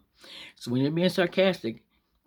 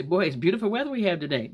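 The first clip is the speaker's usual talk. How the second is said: raised, mock-delighted exclamation; sarcastic; 5 semitones higher than usual. Looking out at a storm, sarcastic. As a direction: feign delight at awful weather. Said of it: "That's being sarcastic".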